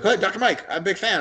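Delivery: put-on high, eager gym kid. Kid greeting Doctor Mike in the gym, high and eager. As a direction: play the starstruck young fan.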